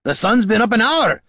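loud, high scolding; exasperated shout. A narrator's reading. Dwarf telling the late ranger the time, exasperated.

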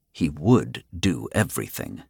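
usual speaking voice

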